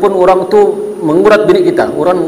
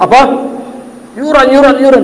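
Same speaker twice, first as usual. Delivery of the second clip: put-on sudden higher, louder snap at the child; irritated shout; 9 semitones higher, 3 dB louder than usual. Father snaps at his child asking for school fees, loud. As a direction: bark it back, fed up.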